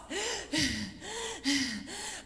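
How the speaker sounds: imitated heavy panting; exhausted gasps. Exhausted teammate stopping mid-run, imitated gasping. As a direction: gasp for air like a spent runner. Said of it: "we were exhausted".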